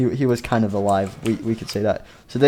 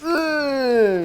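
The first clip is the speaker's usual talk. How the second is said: very high, drawn-out eh; mock pain cry. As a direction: squeal as if bitten. Freddy reacts to bite.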